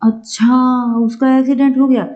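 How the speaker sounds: acted disbelief at sudden news; exclamatory surprise. Shown as a demonstration. Someone hearing of an accident, exclamatory surprise. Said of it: "विस्मयादिबोधक".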